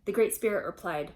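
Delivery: put-on storytelling voice for kids; gentle read-aloud narration; story narration. A narrator's reading. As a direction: read it like a bedtime legend. Reading a legend to children.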